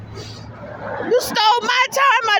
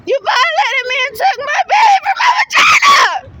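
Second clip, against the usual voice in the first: raised, furious shout at the system; angry shout; 6 semitones higher, 5 dB louder than usual. Real, not acted. Mother shouting at the agency that took her baby, furious. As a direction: yell it out in real outrage.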